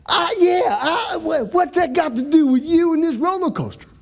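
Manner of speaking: high, stammering bafflement; mock-exasperated comeback; baffled retort. Baffled retort in retold quarrel.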